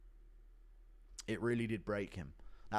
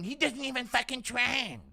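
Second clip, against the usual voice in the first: much higher exclaiming voice; Joe impression; 12 semitones higher, 3 dB louder than usual. Streamer imitating Joe scoffing about a fighter, high. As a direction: blurt it out high and indignant. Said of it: "Impression of Joe saying".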